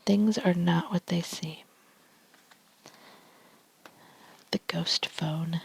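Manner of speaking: ASMR whisper reading tarot cards; hushed ASMR card reading. Real, not acted.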